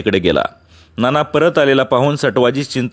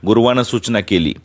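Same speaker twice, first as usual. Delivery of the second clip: plain reading voice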